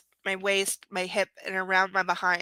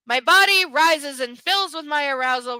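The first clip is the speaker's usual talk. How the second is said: louder, overblown romance-novel read; breathless dramatic; 6 semitones higher, 8 dB louder than usual. Shown as a demonstration. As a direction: ham up the passion. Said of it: "repeats line more dramatically".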